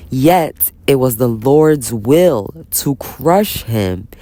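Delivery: slow, solemn Bible reading; scripture reading. Acted. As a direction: read each word like holy text. Reads scripture aloud slowly.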